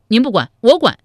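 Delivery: loud, high declaration; forceful insistence. A narrator's reading. The youngest brother loudly insists he will handle it. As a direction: slam it down firmly.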